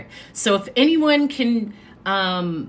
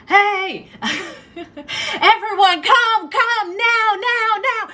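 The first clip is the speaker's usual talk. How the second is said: high, loud frantic rallying call, half-laughing; a boss hustling workers out before a raid, loud and urgent; 11 semitones higher, 4 dB louder, much slower than usual. Retold or imitated. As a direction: hurry the whole room out, way up high. Manager calls staff to hide.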